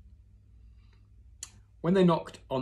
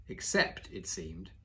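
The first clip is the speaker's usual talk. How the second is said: usual speaking voice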